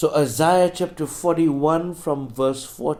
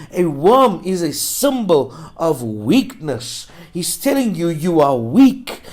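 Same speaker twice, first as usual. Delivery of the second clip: raised, punchy pulpit delivery; emphatic preaching; 4 semitones higher, 7 dB louder than usual. Real, not acted. Preacher makes the verse personal for a congregant, emphatic preaching. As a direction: preach it straight at one listener.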